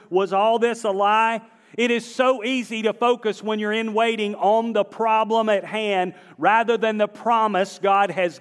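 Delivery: high, driving pulpit delivery; forceful preaching. Real, not acted. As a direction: drive the message home to the congregation.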